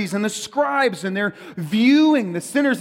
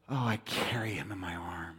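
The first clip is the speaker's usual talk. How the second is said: hushed whisper, much softer; tender whisper; 12 semitones lower, 13 dB quieter than usual. Acted. Jesus tells Pharisees he carries sinners, whispered. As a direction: whisper it like cradling a child. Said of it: "And Jesus says".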